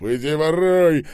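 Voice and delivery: high, very loud outburst; sharp shout. A narrator's reading. Скоба jumping on the mattress, shouting sharply. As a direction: yell it out sharply. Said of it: "резко выкрикнул Скоба".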